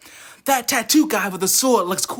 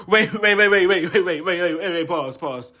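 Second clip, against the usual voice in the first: laughing; 6 semitones lower than usual. Real, not acted. Laughing, retracting his joke.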